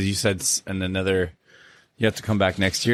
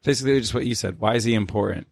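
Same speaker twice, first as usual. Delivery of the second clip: normal talking voice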